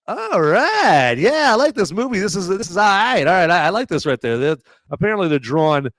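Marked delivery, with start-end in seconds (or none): 0.1-4.4 imagined director's approval. Retold or imitated. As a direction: play an impressed filmmaker in a high voice. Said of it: "they'll be like".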